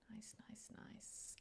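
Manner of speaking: breathy whispered nice. Real, not acted. Whispering praise to her own build. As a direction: whisper it to yourself.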